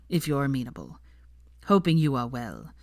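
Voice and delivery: ordinary delivery, nothing marked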